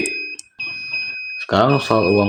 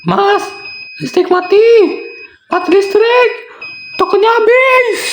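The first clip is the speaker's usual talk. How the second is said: wife's voice. Acted. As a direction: call out like the wife when the power dies. Wife calls out power outage. (istri memanggil suami dengan suara tinggi dan keras)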